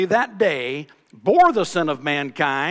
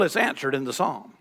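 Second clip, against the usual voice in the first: ordinary talk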